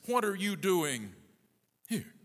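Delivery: high run-up, pause, then here set apart; stress on here. Shown as a demonstration. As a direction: hold back before here and let it stand alone. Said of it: "but the emphasis".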